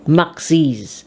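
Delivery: "Max's" as MAK-siz; "Max's" in two syllables; plural ending. Shown as a demonstration. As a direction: sound an added -iz at the end of "Max's". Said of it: "So we say Max's".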